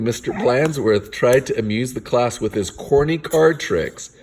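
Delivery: ordinary delivery, nothing marked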